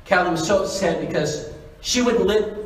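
normal talking voice

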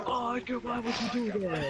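mock complaint. Player imitating how he could scold Edgar, mock exasperation. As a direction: pretend to be exasperated with a friend. Voicing a complaint he isn't making.

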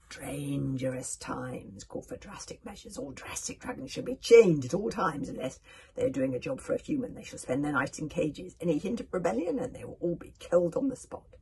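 loud, forceful proclamation; tyrant's decree. A narrator's reading. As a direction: lay down the law to the crowd. Villain king decrees harsh laws.

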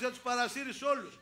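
normal talking voice

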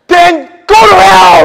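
loud, high, slow hammed-up snap; mock angry retort. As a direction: play the fury tongue in cheek. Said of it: "like, say".

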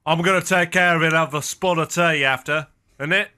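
lightly voiced cockney bloke, offhand; English accent. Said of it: "I've been working on that English accent".

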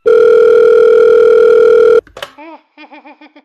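loud rising freaked-out laugh; loud unsettled cackle; loud nervous laugh. Acted. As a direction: laugh off the shock loudly. Nervous reaction after the call.

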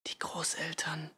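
slow, soft whisper; whispering back. A narrator's reading. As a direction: whisper the answer slowly. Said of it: "flüsterte sie zurück". (langsam zurückgeflüstert)

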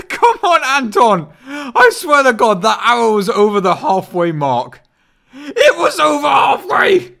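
high, loud, slowed protest yell; frustrated shout. Real, not acted. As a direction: yell at the unfair wheel. Protests the wheel result.

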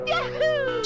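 squeaky high whoop before a shot; high yahoo cry. Real, not acted. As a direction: yelp it up high. Shot-taking ritual cry.

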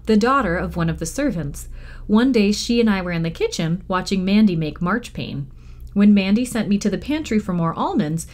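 ordinary delivery

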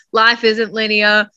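ordinary talk

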